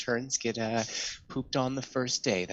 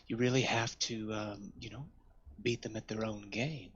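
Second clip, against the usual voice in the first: ordinary talk, nothing marked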